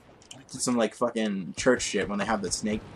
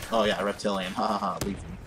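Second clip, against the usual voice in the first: ordinary talk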